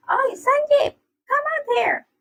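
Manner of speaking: very high, loud squealed shout; deafening call. A narrator's reading. Luffy hollering for Sanji to come out, deafening high call. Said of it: "Luffy's voice was deafening".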